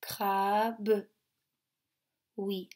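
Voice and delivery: usual speaking voice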